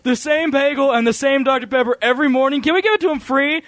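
high-pitched mock request to a boss; hammed-up earnest plea; pleading employee. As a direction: call out high, sarcastically playing an eager employee. Acts employee asking manager.

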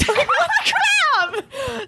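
high, drawn-out exclamation; slow, high-pitched mock exasperation; exasperated outburst. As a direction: stretch it out in exasperated disbelief. Exasperated at the clerk.

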